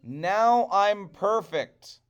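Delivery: slow, high, loud bragging; boastful. Acted. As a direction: brag proudly. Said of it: "said Coyote".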